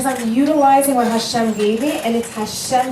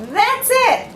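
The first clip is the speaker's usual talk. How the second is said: much higher, burst out with delight; retold eureka shout; a friend seizing on the idea, mock-thrilled; sudden excitement; 4 dB louder than usual. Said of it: "And she was like".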